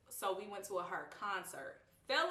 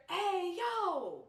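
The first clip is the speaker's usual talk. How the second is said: high, louder call from a distance; 10 semitones higher, 6 dB louder than usual. Retold or imitated. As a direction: call out from a passing truck. Dad calling out from truck.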